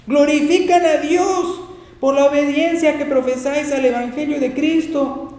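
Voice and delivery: loud, high, slow proclamation; scripture reading. Acted. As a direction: proclaim the verse to the congregation. Reads scripture aloud at full force.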